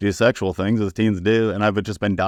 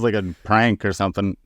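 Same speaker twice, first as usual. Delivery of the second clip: usual speaking voice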